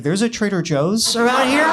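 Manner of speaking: playing it off. Employee covering surprise at boss's news, strained mock-casual. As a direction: feign nonchalance, voice climbing into the question. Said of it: "try to play it off".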